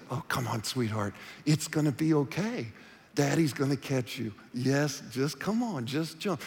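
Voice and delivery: sweet coaxing daddy voice. Retold or imitated. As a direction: coax a scared kid gently into the pool. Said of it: "You start with your sweet voice".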